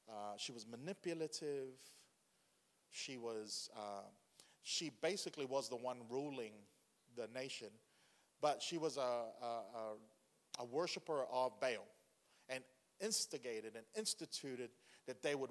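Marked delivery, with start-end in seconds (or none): none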